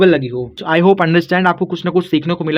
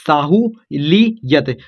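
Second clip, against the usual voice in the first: साहू ली यत very slowly, in separate pieces; syllable by syllable; much slower than usual. Shown as a demonstration. Teacher building the word for students, slow separated syllables. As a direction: break it into Sahu, ली, यत with pauses.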